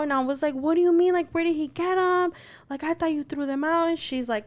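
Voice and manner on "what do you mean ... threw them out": high-pitched retold panic; agitated, higher-pitched questioning; freaking out. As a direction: act out an alarmed mom demanding answers. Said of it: "freaking out".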